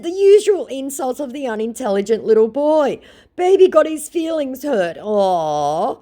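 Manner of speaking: high mocking sing-song; condescending baby-talk taunt. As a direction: tease him like a sulky child. Taunting scammer.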